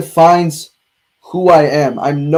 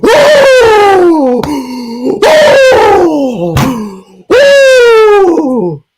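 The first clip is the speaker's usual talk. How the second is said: loud high anguished cries; shocked cry; 8 dB louder, much slower than usual. Real, not acted. Reacting to a shocking moment.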